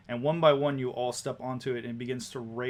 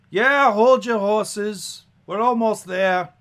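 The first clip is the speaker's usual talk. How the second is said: loud, high carriage driver; yelling out; 9 semitones higher, 12 dB louder, slower than usual. Acted. Woman driving the carriage yells back to her passengers. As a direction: shout it back over the noise of the carriage. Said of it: "she leans back over the side and she yells out".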